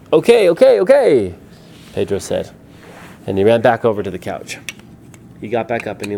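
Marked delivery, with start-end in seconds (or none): 0.1-1.3 high, loud, stretched agreement; pleading storybook surrender; exasperated compliance. Acted. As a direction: give in under pressure, loudly. Pedro yields to the rats.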